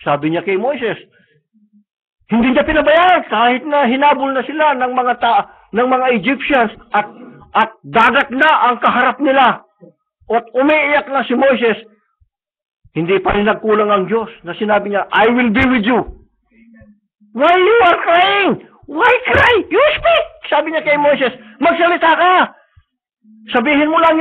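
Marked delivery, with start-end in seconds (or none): 2.3-16.0 high, loud, impassioned sermon; preaching at full force. Acted. Preacher urging the church to claim God's promise, impassioned. 17.3-20.3 much higher, rising shout; God's rebuke. Acted. God to weeping Moses, shouting rebuke.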